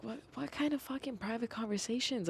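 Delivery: acted disbelief at a false accusation; baffled protest.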